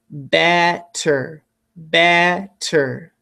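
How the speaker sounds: BETTER slowly, split as "bet... ter"; syllable-by-syllable dictation. Shown as a demonstration. Teacher giving students a spelling word, BETTER slowly split.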